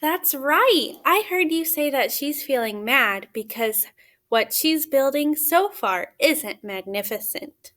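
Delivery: ordinary talk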